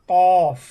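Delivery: loud, emphatic "কফ" from tongue root on palate; tongue-root articulation. Shown as a demonstration. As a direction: punch out "কফ" from the back of the tongue. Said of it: "আমরা এভাবে উচ্চারণ করতে পারি". (জোরে, জিহ্বার গোড়া থেকে "কফ")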